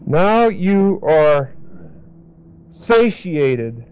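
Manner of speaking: very slow "now you are", then "satiated"; slow emphasis. Shown as a demonstration. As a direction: draw out each word for meaning. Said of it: "what that really means is".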